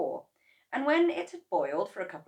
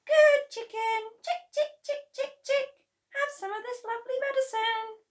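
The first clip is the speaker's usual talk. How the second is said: chick-calling; 8 dB louder than usual. A narrator's reading. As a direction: coo the bird over.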